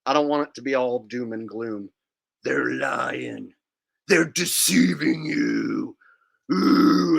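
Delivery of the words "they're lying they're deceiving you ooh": much lower, slow, ominous warning; spooky conspiracy voice. Pretend. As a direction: creep them out like a doom prophet.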